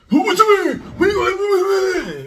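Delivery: very high, loud mock shrieking; hammed-up outraged screech, last words dragged out; going crazy, mocking. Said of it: "they go crazy about that".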